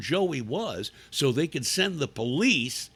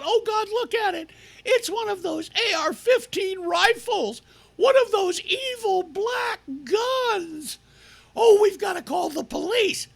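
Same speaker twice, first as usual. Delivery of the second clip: much higher, hammed-up alarm; mock panicked parent; 12 semitones higher than usual. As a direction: parody the hysteria.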